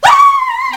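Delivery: shrill, very loud startled scream, half laughing. Retold or imitated. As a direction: let out a sudden high shriek of fright. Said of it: "And I scream".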